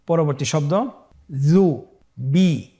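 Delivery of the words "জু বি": "জু, বি" slowly as two separate sounds; syllables apart. Shown as a demonstration. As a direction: split "জু, বি" with a clear pause. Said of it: "এভাবেও পড়তে পারেন". ("জু, বি" ভেঙে ভেঙে ধীরে)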